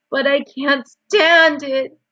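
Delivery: high, loud, drawn-out protest; anguished shout. A narrator's reading. Wilbur can't bear losing Charlotte, shouting. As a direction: shout it, stretching the words in distress. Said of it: "shouted Wilbur".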